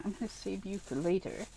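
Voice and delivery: hushed talk to toy; soft aside. Real, not acted. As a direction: murmur softly to it. Talking softly to plush.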